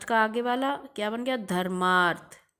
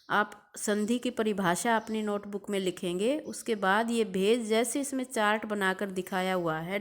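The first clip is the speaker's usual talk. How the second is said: usual speaking voice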